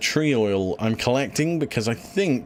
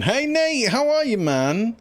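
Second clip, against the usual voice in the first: excited greeting; 11 semitones higher, slower than usual. Real, not acted. Greeting a chat viewer.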